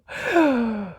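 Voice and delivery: long put-upon sigh; a moaning tinker's sigh; heavy sigh. Acted. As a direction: sigh like the world is too much.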